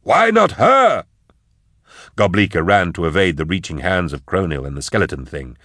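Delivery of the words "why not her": extremely high, drawn-out scream of protest; terrified shriek. A narrator's reading. Fleeing man repeating it in terror, shrieked wail. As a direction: let it break out very high and slow. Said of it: "he cried".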